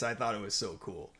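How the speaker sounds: usual speaking voice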